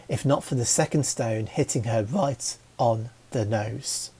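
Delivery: plain reading voice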